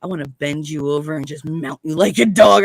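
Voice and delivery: imitated man, voice dropped very low; low-pitched lecherous phone come-on; lewd caller. Caller mistaking her for his wife, lewd and low.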